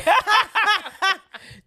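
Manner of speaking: high loud burst of laughter. Real, not acted. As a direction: crack up loudly. Laughing at the guess.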